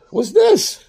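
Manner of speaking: squeaky high surprised what's this. Real, not acted. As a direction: jump up in pitch in disbelief. Surprise at bonus card.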